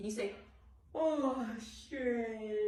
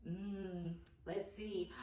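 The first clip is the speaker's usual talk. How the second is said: ordinary talk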